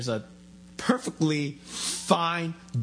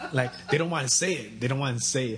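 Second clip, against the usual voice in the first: ordinary delivery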